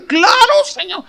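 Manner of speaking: exclamación muy aguda al Señor; protesta aguda. Retold or imitated. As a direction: contesta protestando, con la voz muy arriba. (protesta en tono agudísimo)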